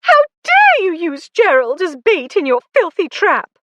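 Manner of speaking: high, loud furious tirade; avenging fury. A narrator's reading. Lucy berating Alec for using Gerald as bait, high and furious. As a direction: let the rage pour out. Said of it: "she yelled at Alec".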